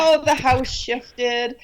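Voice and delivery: slow, dismissive husband brushing it off; lightly voiced doubting husband; sceptical husband. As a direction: shrug it away slowly. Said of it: "And he, at first".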